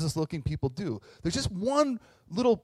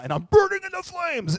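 mock despair; 14 semitones higher, 4 dB louder than usual. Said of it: "You're like".

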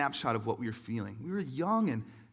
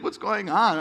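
asked through laughter, higher and louder; laughing question; 5 semitones higher, 10 dB louder, slower than usual. Retold or imitated. Wife laughing at the crying husband, amused. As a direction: quote the wife laughing at him. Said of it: "she's kinda laughing at me".

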